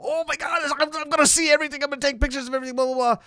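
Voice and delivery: high, fast giddy gush; giddy excitement. Retold or imitated. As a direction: gush like a wide-eyed young tourist. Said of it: "young and just like".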